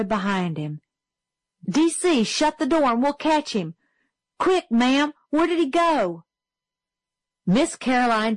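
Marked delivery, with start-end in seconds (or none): 1.7-6.2 put-on high, urgent boyish voice; eager schoolboy. A narrator's reading. Little Chuck urging the teacher to point out the mouse, eager and high. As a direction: call out quick and keen.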